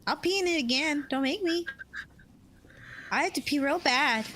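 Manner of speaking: put-on high, whining kid's voice; whiny child. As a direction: whine like a naughty little boy. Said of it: "Do you sound like".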